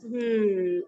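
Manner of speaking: ordinary delivery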